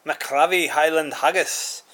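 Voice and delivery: loud, slow, hammed-up Scottish accent; attempted Scottish accent. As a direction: have a go at a big, high Scottish burr. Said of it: "not very good with that accent".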